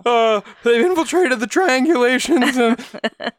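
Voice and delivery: high-pitched, drawn-out panic; hammed-up stammering conspiracy caller; mock frantic caller. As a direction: imitate a caller too worked up to finish. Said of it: "Sean, he is frantic".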